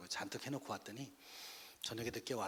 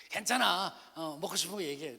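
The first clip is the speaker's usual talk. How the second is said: loud, high "no problem" dad voice; imitating a father cheerfully brushing it off; acted hearty reassurance; 5 semitones higher, 9 dB louder than usual. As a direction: boom out reassurance over the phone. Father brushes off bad report card.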